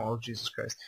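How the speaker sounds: normal talking voice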